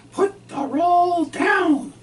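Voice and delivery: high, loud, very slow stern wife voice; mimicking his wife. Husband voices his wife ordering him off the bread, high, loud and slow. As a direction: drag out a squealing command. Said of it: "Lucille says".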